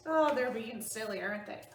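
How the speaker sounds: ordinary talk, nothing marked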